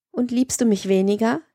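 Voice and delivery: usual speaking voice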